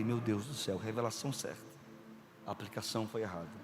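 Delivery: low, soft thought to himself; quietly dismayed, under his breath; a hushed private groan; muttered aside. Retold or imitated.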